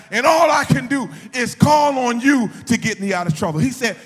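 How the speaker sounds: loud, high-pitched sermon shout; full-force preaching. Real, not acted. Preacher tells how father said to call on him, shouting. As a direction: drive it home to the congregation at full volume.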